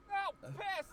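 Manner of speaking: mock terrified exclamation; startled yelp. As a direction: jump at the light turning on. Light turns on in the dark.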